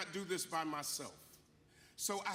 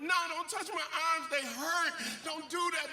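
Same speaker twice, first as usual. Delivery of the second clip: higher, mock-dramatic whimper of complaint; whiny baby; 7 semitones higher, 4 dB louder than usual. To his wife offering a rub, mock-dramatic whine. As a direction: play the big baby about sore arms. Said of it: "I was being such a baby, so dramatic".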